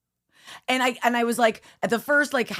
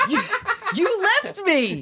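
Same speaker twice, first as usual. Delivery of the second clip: put-on much higher, slow, wailing kid's accusation; crying little boy; 14 semitones higher than usual. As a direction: drag it out like a hurt child. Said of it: "he goes".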